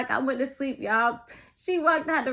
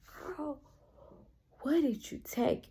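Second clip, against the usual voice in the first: soft, concerned mock question; hushed questioning; 3 semitones higher, 10 dB quieter than usual. House mama questioning the panicking girl, acted hushed voice. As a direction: ask it very softly, imitating the onlooker.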